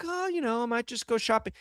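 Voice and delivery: high, breezy small-talk voice; airy and carefree; mock airy woman.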